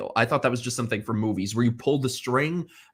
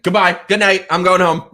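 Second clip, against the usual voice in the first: louder, brisk brush-off; curt exit; 5 semitones higher, 10 dB louder than usual. Retold or imitated. Leaving the bad date, loud brisk brush-off. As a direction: slam the door on the date. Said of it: "I'm like".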